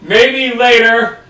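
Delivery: character voice. Acted. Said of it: "I know I did the voice badly".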